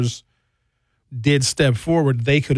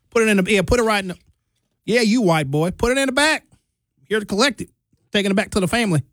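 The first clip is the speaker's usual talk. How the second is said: put-on a Southern accent, pitched up for dramatic effect; mock Southern accent; 7 semitones higher, much faster than usual. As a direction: play a Southern delivery guy with a put-on Southern accent. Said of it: "I'd have to have a Southern accent".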